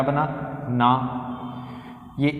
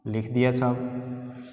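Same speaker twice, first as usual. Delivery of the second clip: slow, soft drawl to himself; slow and quiet, half to himself; drawn-out aside; 4 semitones lower, 7 dB quieter, slower than usual. Real, not acted. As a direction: stretch it out lazily while writing. Teacher's drawn-out aside while writing.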